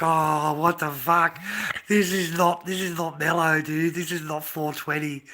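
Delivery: panicking and wanting out now; retold panic. Seeing his mate sick on the floor, loud panicked freak-out. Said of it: "I'm fucking panicking".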